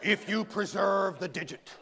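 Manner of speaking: slow, formal doctor phrasing, lightly voiced; a hint of a pompous doctor. Doctor telling him about reattaching the toe, lightly voiced pompous. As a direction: lean on the jargon lightly. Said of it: "That's what he called it".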